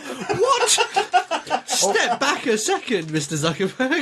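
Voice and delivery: very high-pitched comic outrage; hammed-up, high, laughing indignation; mock incredulous reaction. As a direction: squeal "what" in disbelief, then scold slowly. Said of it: "And I thought".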